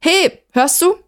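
high-pitched call through a door. A narrator's reading. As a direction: call urgently for attention. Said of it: "rief sie durch die geschlossene Tür".

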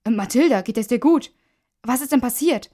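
alarmed concern. A narrator's reading. Colleague to the woman who stumbled in, alarmed. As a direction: ask with startled concern.

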